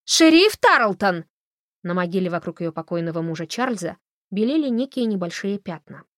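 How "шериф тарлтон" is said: high, loud call across the graveyard; calling out across the cemetery. A narrator's reading. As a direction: shout her name to get her attention.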